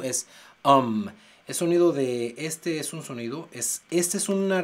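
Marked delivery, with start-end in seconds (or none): 0.0-1.1 um with a short open schwa; um sound. Shown as a demonstration. Teacher showing the um vowel in Autumn. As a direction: say um, not U. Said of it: "no es sonido de U".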